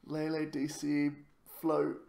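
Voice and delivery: ordinary delivery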